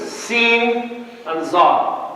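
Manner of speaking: Seen and Zar, slow and deliberate; letter names spelled out. Shown as a demonstration. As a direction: drag out Seen and Zar so each letter lands. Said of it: "So that's the difference".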